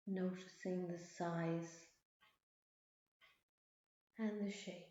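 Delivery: soft, unhurried meditation voice; guided meditation. Acted. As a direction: gently guide their attention. Leads a mindfulness meditation.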